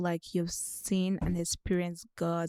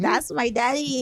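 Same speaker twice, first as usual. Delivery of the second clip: higher, louder, slow proud boast; proud son; 6 semitones higher, 5 dB louder, slower than usual. Retold or imitated. Host imitating him bragging about God as a proud son. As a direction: beam like a kid bragging about his dad. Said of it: "it's like a proud son".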